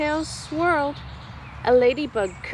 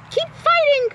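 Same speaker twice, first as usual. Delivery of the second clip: much higher, loud encouraging call; rallying call; 4 dB louder than usual. Acted. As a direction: urge the troops on. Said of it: "called Dilly".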